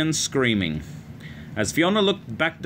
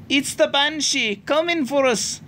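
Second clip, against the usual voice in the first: put-on high, frightened child's cry; terrified scream. A narrator's reading. Little girl woken by pounding, screaming about the Banshee. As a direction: shriek it in panic as you wake. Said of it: "Ailish screamed".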